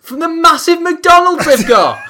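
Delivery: high, loud announcer cry; hammed-up stadium announcer yell; mock announcer shout. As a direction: bellow it like a stadium PA.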